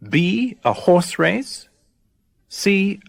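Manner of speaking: ordinary talk, nothing marked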